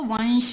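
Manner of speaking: वंश with a nasal n, not वमश; nasal n sound. Shown as a demonstration. Teacher showing students the nasal anusvara in वंश. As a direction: voice वंश through the nose. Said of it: "वमश नहीं पढ़ेंगे".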